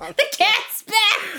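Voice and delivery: high, very slow, loud holler; mock yell to Ma. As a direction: stretch it into a hammed-up household shout. Joins the Jersey yelling-at-Ma bit.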